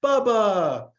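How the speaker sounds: high, loud, drawn-out hail; guards calling out. Retold or imitated. Italian guards at the Vatican hailing Bubba, calling out. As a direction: belt out the name in greeting. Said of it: "they're all Italian".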